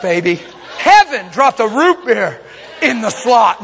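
ordinary delivery

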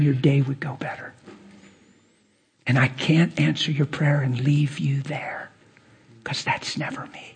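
soft unvoiced whisper, tender; hushed, intimate whisper; whispered divine voice. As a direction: whisper it like God speaking close. God's words to the wife, retold.

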